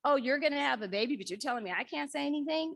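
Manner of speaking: fast, indignant retort to the radio; quick mock indignation; mom talking back. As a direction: rattle it off fast like an outraged mom. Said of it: "my mom will talk back to the songs".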